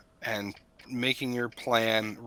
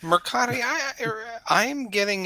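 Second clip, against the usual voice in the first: amused excitement; 11 semitones higher than usual. Real, not acted. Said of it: "old man in the bathrobe from Family Guy vibe".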